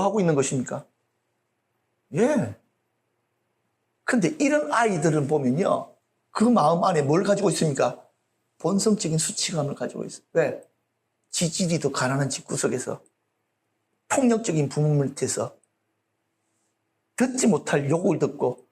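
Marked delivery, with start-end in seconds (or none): none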